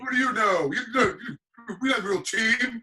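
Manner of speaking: high, loud mock outrage; indignant fan. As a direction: imitate an offended fan, pitched well up. Acting out indignant Cowboys fan.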